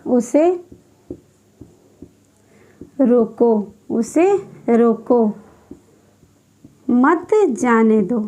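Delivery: मत जाने दो as one separate phrase; pause after रोको. Shown as a demonstration. Teacher dictating the example, pausing after रोको. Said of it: "अब देखिए जो दूसरा उदाहरण है".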